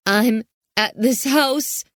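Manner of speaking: put-on high, slow frightened girl; a teenage girl holding back tears; scared girl voice. A narrator's reading.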